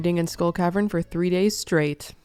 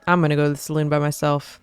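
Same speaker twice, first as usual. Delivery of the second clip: ordinary talk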